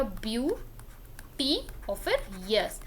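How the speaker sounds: ब्यूटी और फिर यस said slowly and softly, split in two parts; ब्यूटी और फिर यस, soft syllable-by-syllable demo; spelled-out pronunciation. Shown as a demonstration. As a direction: break ब्यूटीएस into ब्यूटी then यस, low and slow. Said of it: "ब्यूटीएस का pronunciation ध्यान रखिएगा".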